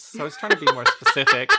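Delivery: quick, breaking into real laughter; laughing self-defense. Real, not acted. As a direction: rush the excuse out through a laugh. Defending herself while cracking up.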